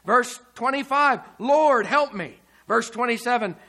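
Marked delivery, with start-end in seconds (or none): 1.4-2.4 raised, higher quoted plea; louder, lifted cry of appeal; plea for help. Retold or imitated. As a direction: call out for help. Voicing the woman's plea.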